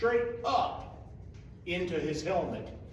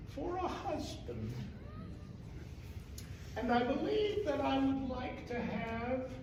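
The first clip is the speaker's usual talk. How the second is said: lightly voiced hag; 8 semitones higher than usual. Old hag voiced by teller.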